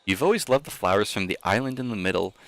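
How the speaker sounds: plain reading voice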